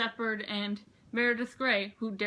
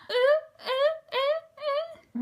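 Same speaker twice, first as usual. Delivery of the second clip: very high, loud ooh vocal runs; fading high ooh notes; high ooh singing; 7 dB louder, much slower than usual. Real, not acted. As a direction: belt out playful oohs. Vocalizing after the song.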